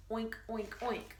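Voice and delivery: quiet imitated oinking; soft pig oinks. Pig boy oinking quietly in the silent crate. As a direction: grunt like a caged pig. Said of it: "Only thing making noise is me".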